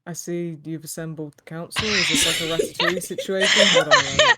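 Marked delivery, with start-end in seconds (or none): 1.9-4.4 shouting. Real, not acted. Laughing shout at the council.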